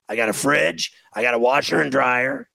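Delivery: usual speaking voice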